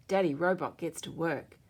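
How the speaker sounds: ordinary delivery, nothing marked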